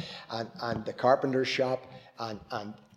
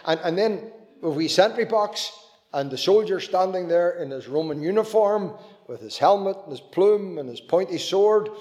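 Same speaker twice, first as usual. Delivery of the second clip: usual speaking voice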